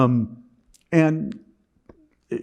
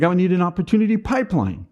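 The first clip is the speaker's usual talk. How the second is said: excited declaration; 6 semitones higher than usual. Retold or imitated. Repeats quote with more lift.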